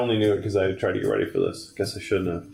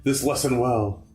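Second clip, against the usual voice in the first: game villain; 7 semitones higher than usual. Acted. Gamer voicing the game's villain dialogue, hammy high villain voice. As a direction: declaim it as a high-pitched villain threat. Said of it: "I guess we gotta do voices".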